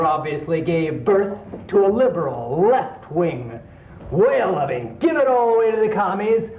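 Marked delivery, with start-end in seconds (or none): none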